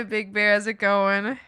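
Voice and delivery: normal talking voice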